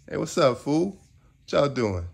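high, loud hey what's up; friend's excited greeting. Retold or imitated. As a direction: call out to an old friend.

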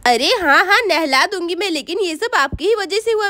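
ordinary talk

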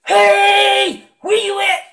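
shouted high and hard, the wrong way to start; full shout of the caller who starts too loud; loud yell. Shown as a demonstration. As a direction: bellow it like a too-loud call. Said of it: "yell through your tube and say".

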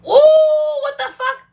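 high, loud crying out; high anguished what the fuck; exasperated shriek. Real, not acted. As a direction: wail in disbelief. Frustrated by the accent.

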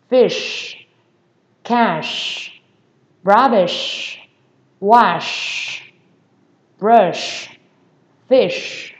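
word list with long shushing endings; strongly hissed SH. Shown as a demonstration. Teacher drilling final sounds, strong long SH. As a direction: hiss the SH hard at the end of "brush, fish, cash, rubbish, wash". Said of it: "các bạn xì ra, mạnh lên".

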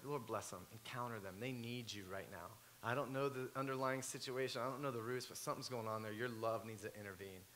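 soft, low, earnest prayer; quiet prayer. Retold or imitated. Preacher praying for neighbors who cursed him, soft and low. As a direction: pray it under your breath.

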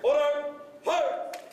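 sharp, drawn-out military command calls; drill commands. Acted. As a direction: call each command crisply to the squad.

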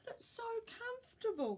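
put-on high pleading old man voice; pleading Grandpa. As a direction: plead to keep the cardigan. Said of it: "Grandpa told her".

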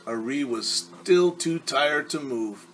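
ordinary delivery, nothing marked

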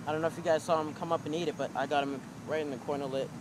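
ordinary talk

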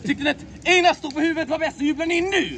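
rally the crowd to cheer; host shouting to the audience for cheers; calling to the crowd. Acted.

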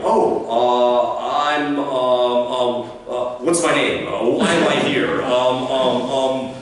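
mock caught-off-guard stammer, full of fillers; flustered fumbling. Unprepared graduate at a networking event, flustered. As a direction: stumble loudly through a botched introduction. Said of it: "Now is a lousy time to go".

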